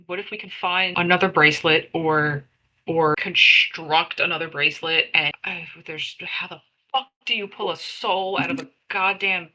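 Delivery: ordinary talk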